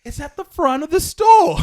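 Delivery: high, loud comic outburst; exasperated shout. Acted. Comedian rants about where the registers are, exasperated shout. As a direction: yell the obvious answer. Exasperated rant at the customer's mistake.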